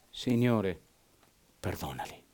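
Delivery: soft, low, fading plea; hushed dying plea. Retold or imitated. Preacher voicing dying Stephen's soft plea. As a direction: breathe it out like a dying man.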